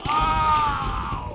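sudden high yell; loud shout. Real, not acted. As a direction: belt out a startled oh. Shouts during fight.

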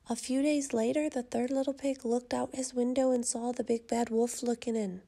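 usual speaking voice

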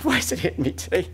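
a quiet question through welling emotion; choked up. Real, not acted. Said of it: "I'm very sorry I got, whew, emotional on it".

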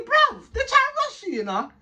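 fired-up mock yell; retold shout. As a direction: yell it out in outrage. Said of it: "I was thinking".

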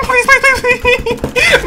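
laughing plea. Real, not acted. Laughing, pleading during the fight.

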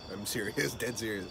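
ordinary talk, nothing marked

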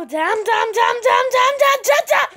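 high mouth shooting sounds; blaster noises. Pretend. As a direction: fire with your voice. Attacking monsters.

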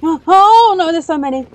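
loud, high dismay, repeated and rising; mounting panic. Real, not acted. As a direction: cry out as the danger multiplies. Sees many monsters.

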